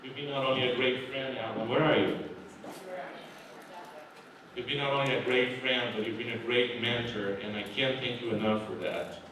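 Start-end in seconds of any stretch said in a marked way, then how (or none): none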